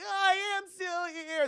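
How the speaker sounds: high, loud, slow struggling cry; high desperate cry. Retold or imitated. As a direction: force it out high and slow, fighting back. Mother fighting possession.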